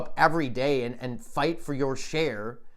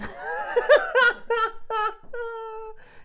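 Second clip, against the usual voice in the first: high burst of laughter. Real, not acted. Comedian cracks up at own joke.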